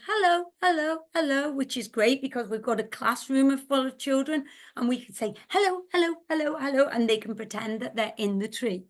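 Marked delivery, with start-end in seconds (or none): 0.0-1.5 high overlapping little hellos; many tree voices. Acted. Teacher as voices all over the tree, high greetings. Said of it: "voices all over the tree were saying". 5.5-6.8 high, quick repeated hellos; many tree voices. Shown as a demonstration. Children pretending they're in the tree, high quick hellos. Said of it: "voices all over the tree were saying".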